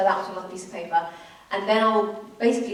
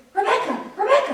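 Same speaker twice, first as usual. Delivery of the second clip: high-pitched call for attention; mock excited hailing; imitated calling out; 13 semitones higher, 4 dB louder than usual. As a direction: call a name as if across a crowd, pitched high. Imitates woman calling her name.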